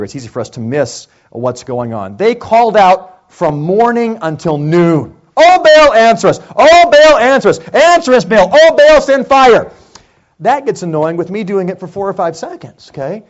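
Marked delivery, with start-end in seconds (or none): 5.4-9.7 loud, high chant to Baal; prophets calling out. Acted. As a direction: cry out to a god, pleading for fire.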